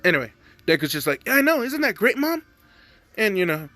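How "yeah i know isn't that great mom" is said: excited son. Retold or imitated. Son retelling to mom, eager higher voice. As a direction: redo the line, eager and a bit high. Redo of the cracked line.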